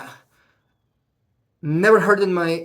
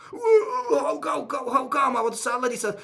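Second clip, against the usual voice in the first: higher, crying disbelief at the refusal; shocked crying how; 6 semitones higher than usual. Retold or imitated. Refused buyer to broker, crying in shocked disbelief. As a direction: wail it like the news just hit.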